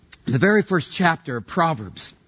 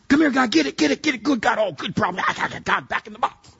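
calling a dog; 8 semitones higher than usual. Acted. Preacher calling God to fix a problem like a pet, dog-owner voice. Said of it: "treated God like a big dog".

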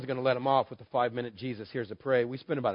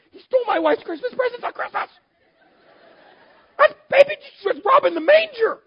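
much higher and much louder, shouting; outraged yell; 11 dB louder than usual. Acted. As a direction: scream it out in mock outrage. Outburst about the enemy.

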